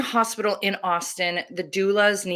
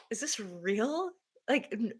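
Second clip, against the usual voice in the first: hushed disbelief; 2 semitones higher, 11 dB quieter than usual. Retold or imitated. Disbelief at masked OB.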